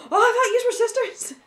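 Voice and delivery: high, loud mock astonishment with laughter; imitated surprise. Imitating the hairdresser mistaking spouses for sisters, exaggerated surprise. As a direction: mimic her big startled realization, laughing.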